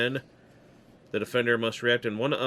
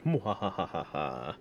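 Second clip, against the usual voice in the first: evil cackle; 7 semitones lower, 6 dB quieter, slower than usual. Pretend. Evil laugh for spooky adults.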